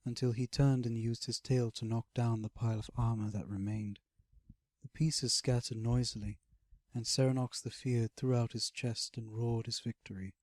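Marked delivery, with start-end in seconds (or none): none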